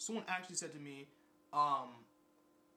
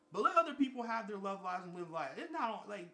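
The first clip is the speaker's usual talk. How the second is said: normal talking voice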